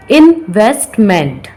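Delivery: investment drawn out slowly in three beats; syllable by syllable. Shown as a demonstration. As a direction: say investment slowly as in, vest, ment. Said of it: "example of three syllable word". (धीरे-धीरे, हर syllable अलग)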